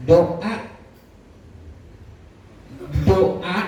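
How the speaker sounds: ordinary talk, nothing marked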